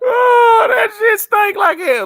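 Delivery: high, slow, loud laughing outcry; laughing shout. Real, not acted. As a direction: howl it while cracking up. Laughing about goose grease smell.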